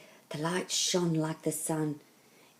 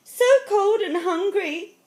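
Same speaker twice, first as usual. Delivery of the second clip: high, loud, slow lament; suffering girl; 8 dB louder than usual. A narrator's reading. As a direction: wail it as a cold, worn-out girl. Exhausted girl laments in snow.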